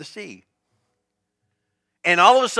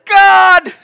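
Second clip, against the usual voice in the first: high, loud desperate cry with a laugh; Moses wailing at God; 12 semitones higher, 8 dB louder than usual. Retold or imitated. Moses shouting at God, high and loud in mock panic. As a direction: throw a panicked cry up at heaven.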